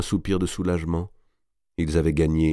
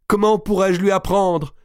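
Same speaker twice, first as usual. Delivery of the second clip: very high, louder anguished cry; distraught question; 13 semitones higher, 6 dB louder than usual. A narrator's reading. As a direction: ask in desperate distress. Marie affolée pense à Guccio. (question affolée, voix très aiguë)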